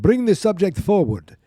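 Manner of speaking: high, loud mock villain's order; commanding villain. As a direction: call out a hammy command in character. Acted command from villain doctor.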